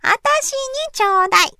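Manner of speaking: put-on a little girl whining for candy; high whiny begging. A narrator's reading. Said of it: "せがみました".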